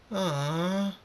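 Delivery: high, drawn-out group whine; disappointed foals. A narrator's reading. Fillies and colts told to go home, disappointed. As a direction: draw it out high, like let-down kids.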